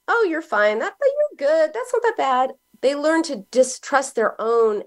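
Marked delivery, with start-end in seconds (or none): 0.1-2.5 higher, light mock reassurance; nice way. A parent dismissing a child's hurt, gently, in a higher mock reassuring voice. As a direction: brush it off kindly to a hurting child. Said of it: "Even in a nice way".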